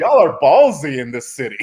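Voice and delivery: amused bystander calling out the room; laughing mock scold. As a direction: tease them while cracking up. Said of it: "I'm just like".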